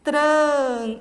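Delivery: "tran" loud and held; teacher isolates a loud, sustained "tran"; strong syllable. Shown as a demonstration. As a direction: punch out "tran" as the stressed syllable. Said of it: "palavra mais forte que saiu".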